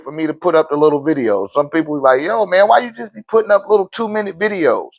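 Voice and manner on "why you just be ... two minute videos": ordinary talk, nothing marked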